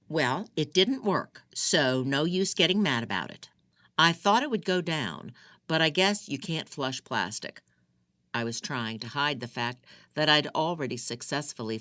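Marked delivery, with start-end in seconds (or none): 0.1-7.6 defiant excuse. Acted. Mona defiant after being caught.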